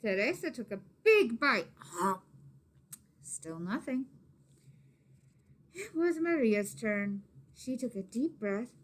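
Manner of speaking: usual speaking voice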